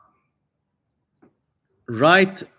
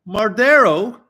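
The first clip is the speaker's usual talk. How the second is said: Mardero called out higher and louder, relishing it; playful louder higher Mardero; mock dramatic exclaim; 6 semitones higher, 8 dB louder than usual. Shown as a demonstration. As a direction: savour the name Mardero like an announcer.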